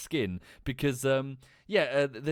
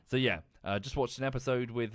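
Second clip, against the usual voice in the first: usual speaking voice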